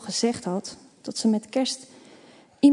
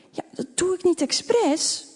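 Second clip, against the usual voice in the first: indignant whisper; 8 semitones higher than usual. A narrator's reading. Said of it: "fluistert Milou verontwaardigd".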